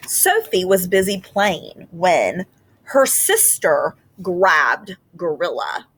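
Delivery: slow, expressive read-aloud with long pauses; reading to children. A narrator's reading. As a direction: read it gently to a class of kids. Teacher reads picture book aloud.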